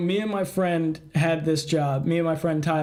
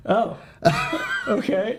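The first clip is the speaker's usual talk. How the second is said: bemused lady; 7 semitones higher, slower than usual. Retold or imitated. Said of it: "the lady just looks at me and goes".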